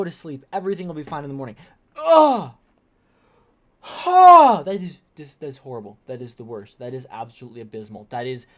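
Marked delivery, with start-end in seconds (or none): 2.0-2.5 loud, high groan of revulsion; loud revolted ugh; disgusted groan. Real, not acted. Reacting in disgust. 3.8-4.6 high, loud scoff of horror; a loud, appalled huh; shocked scoff; loud disgusted huh. Real, not acted. Reacting in disgust.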